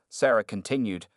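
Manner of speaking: ordinary delivery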